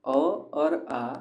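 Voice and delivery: अ और आ said very slowly; drawn-out vowels. Shown as a demonstration. Teacher slowly sounding out the vowels अ and आ. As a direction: stretch अ and आ out clearly. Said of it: "कंठ से उच्चारित करते हैं".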